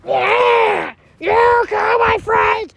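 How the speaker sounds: loud excited yell; hyped loud cheering shout. Real, not acted. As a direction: yell it out at the storm. Cheering and yelling at the storm.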